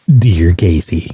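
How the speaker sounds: low, drawn-out radio host impression; Casey Kasem. Countdown host opening a listener's letter, low radio impression. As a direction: read it like an old countdown host. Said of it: "it was hosted by Casey Kasem".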